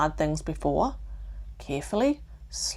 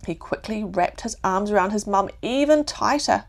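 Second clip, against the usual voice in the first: ordinary delivery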